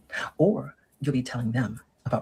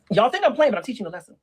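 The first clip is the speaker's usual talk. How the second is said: higher, louder, insistent; emphatic; 8 semitones higher, 4 dB louder than usual. Real, not acted. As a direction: push it up hard at the audience. Sudden emphatic aside.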